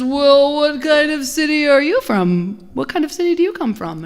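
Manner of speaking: lightly voiced guard questioning; guard's voice. Guard in the parable questioning the second stranger, lightly voiced.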